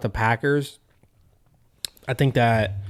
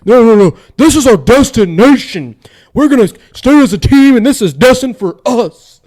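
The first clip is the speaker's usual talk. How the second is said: screaming fired-up coach speech; Dan Campbell speech; 12 semitones higher, 12 dB louder than usual. Retold or imitated. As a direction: roar it like a locker room coach. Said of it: "out of breath just fucking reenacting what he says".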